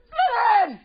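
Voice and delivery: very high shouted answer; hammed-up high shout; mentally challenged wizard. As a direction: yell the house name way up high. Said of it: "my impression of a mentally challenged wizard".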